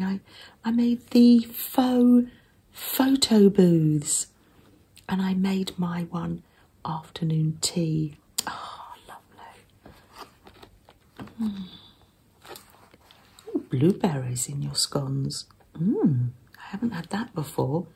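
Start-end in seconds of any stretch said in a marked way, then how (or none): 5.1-15.5 soft close whisper; ASMR whisper. Real, not acted. Showing a handmade zine to viewers in a gentle whisper. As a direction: hush every word for the listener. ASMR journal flip-through.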